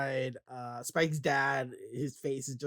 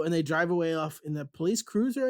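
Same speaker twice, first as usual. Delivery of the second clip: normal talking voice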